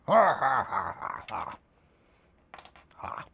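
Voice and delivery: slow, drawn-out menacing orc cackle; slow orc laugh. Acted. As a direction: cackle like a brute. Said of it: "a real proper orc voice".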